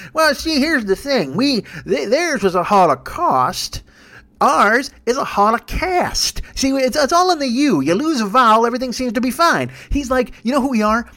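mock Southern senator explaining; Jeff Sessions. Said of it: "Jeff Sessions ... and he's like".

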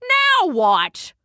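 high exasperated outburst; shouting. A narrator's reading. Queen bee protesting the failed witness, shouting. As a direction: raise the voice sharply in protest. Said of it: "shouted the queen bee".

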